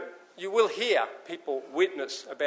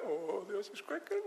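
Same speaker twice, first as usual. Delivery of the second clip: hushed alarm; 4 semitones higher, 14 dB quieter than usual. Acted. Man on cracking ice.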